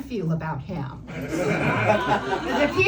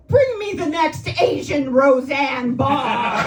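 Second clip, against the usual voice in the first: mock eager casting call, slow and loud; hammed-up demand through a laugh; laughing mock casting director; 3 semitones higher, 8 dB louder, slower than usual. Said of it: "casting directors out there going".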